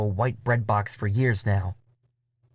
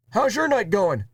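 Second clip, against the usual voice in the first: Murray's voice; 11 semitones higher than usual. Acted. Murray quips to narrator in the pit, high character voice. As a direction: pitch way up for Murray. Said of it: "he asks".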